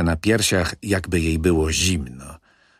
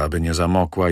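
ordinary delivery, nothing marked

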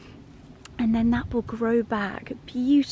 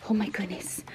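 whispered; 3 semitones lower, 6 dB quieter, faster than usual. Real, not acted. Awed by the dog's excitement.

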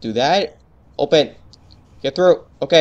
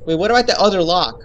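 ordinary talk, nothing marked

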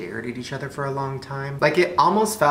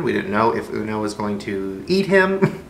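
ordinary delivery

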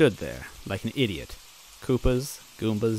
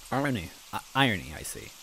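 usual speaking voice

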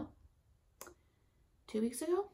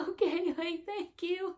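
giddy relieved thanks; 10 semitones higher than usual. Retold or imitated. Laughing relief after good news.

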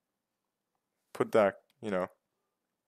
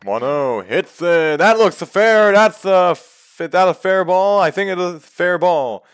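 rising, louder live call; excited play-by-play; 6 semitones higher, 8 dB louder than usual. Acted. Gamer calling a hit in his ballgame, excited.

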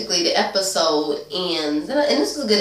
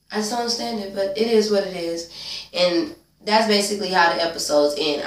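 ordinary talk

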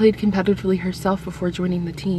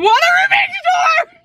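excited shout; 6 dB louder than usual. Real, not acted. Reads her own quote aloud.

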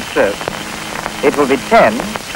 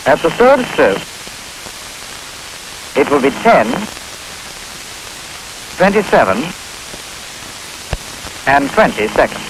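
choppy recorded clock voice; pieced-together speaking clock; spliced together words; 5 dB quieter than usual. Real, not acted. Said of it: "The complete announcement is broken into sections".

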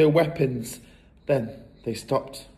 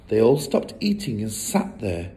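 ordinary delivery, nothing marked

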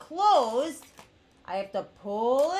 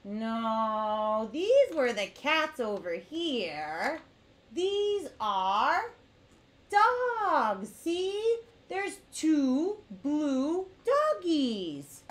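ordinary delivery

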